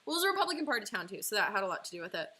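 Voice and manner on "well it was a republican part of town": ordinary talk, nothing marked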